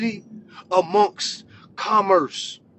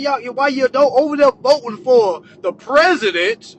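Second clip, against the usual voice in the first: usual speaking voice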